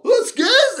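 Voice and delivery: high, loud mocking squeal; mocking friend. Phil on the lift teasing, high loud. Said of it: "He's all".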